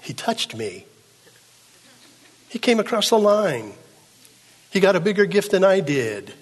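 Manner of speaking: high, loud whiny complaint of a sulking sibling; childish elder brother. Retold or imitated. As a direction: whine it like a jealous child tattling. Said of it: "The childishness of the elder brother".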